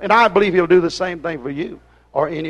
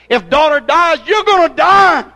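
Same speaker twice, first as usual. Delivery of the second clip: higher and louder to the peak on die; climax shout; 6 semitones higher, 5 dB louder than usual. Acted. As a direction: push it to a ringing climax. Refrain climbing to a peak.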